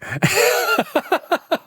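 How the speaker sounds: high, bright laugh; high laughter. Real, not acted. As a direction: burst out laughing. Laughing at own remark.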